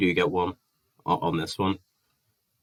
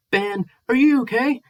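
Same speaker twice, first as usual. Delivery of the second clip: much higher and louder, sugary mocking voice; mock fake concern; 5 dB louder than usual. Ben mocks Gareth texting to ask if he is okay, high simpering voice.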